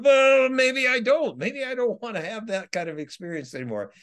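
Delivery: a lightly voiced talk-back to the spirits; mock reluctant reply. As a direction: answer the spirits with a rueful, hammed-up protest. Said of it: "And they're like".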